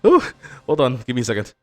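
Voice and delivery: breathless, gasping between words; panting after running; out of breath. Acted. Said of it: "they sound out of breath as shit".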